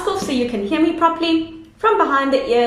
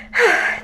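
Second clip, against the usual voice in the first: tired sigh; 8 semitones higher than usual. A narrator's reading. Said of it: "collapsed in a heap".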